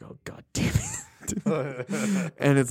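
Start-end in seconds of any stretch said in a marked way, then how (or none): 0.0-0.8 breathy under-the-breath curse; muttered curse. Retold or imitated. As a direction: grumble it to herself. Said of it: "Dee is like".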